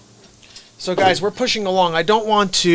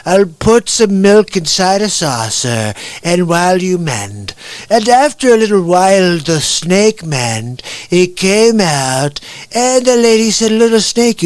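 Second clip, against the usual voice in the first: slow, emphatic storytelling impression, often higher; Donald Trump; 3 semitones higher, 4 dB louder, slower than usual. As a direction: recite a fable like a rally speech, stretching words. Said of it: "You ever heard Trump's poem about the snake".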